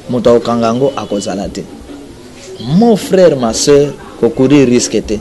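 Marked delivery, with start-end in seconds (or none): none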